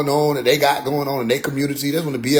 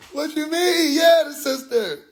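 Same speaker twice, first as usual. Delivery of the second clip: high, slow acted voices; mock confused exchange; 13 semitones higher, slower than usual. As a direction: play the confused caller. Acting out Clubhouse exchange.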